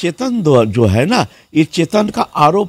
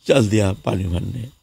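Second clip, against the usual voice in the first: normal talking voice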